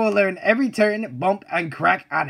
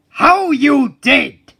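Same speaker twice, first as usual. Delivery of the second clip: angry outburst; 7 semitones higher, 7 dB louder, much slower than usual. Acted.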